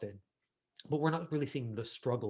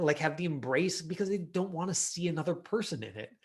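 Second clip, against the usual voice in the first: higher, louder, slowed disbelief; exasperated; 7 semitones higher, 7 dB louder than usual. Real, not acted. Coach venting about an impossible audition brief, exasperated. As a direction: spell out how absurd the setup is.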